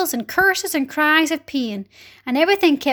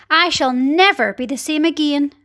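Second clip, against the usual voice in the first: plain reading voice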